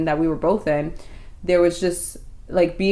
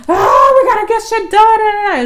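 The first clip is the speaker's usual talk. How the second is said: loud, high, drawn-out caricature shout; mock alpha male; 10 dB louder than usual. Girlfriend mocking what he is not, alpha-male shout. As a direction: roar like an overblown alpha male. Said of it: "he's not an alpha male. Like, he's not like a".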